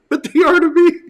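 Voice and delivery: high, loud cry of protest; indignant protest. Retold or imitated. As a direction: burst out defending theme parks. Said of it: "I was like".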